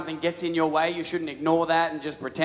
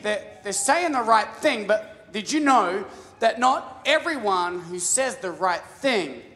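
normal talking voice

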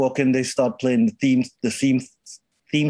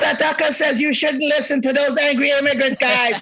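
mock New Jersey; 10 semitones higher than usual. Husband calls to his wife Martha in a mock New Jersey accent.

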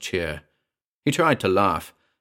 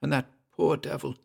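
softer, higher-pitched pity; hushed, uneasy aside; 6 semitones higher, 5 dB quieter than usual. A narrator's reading. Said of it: "in a hushed voice".

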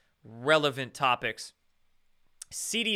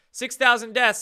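exclaiming; 8 semitones higher, 7 dB louder than usual. Real, not acted. Exclaiming the number.

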